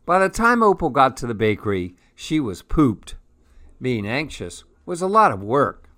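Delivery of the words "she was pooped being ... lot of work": ordinary delivery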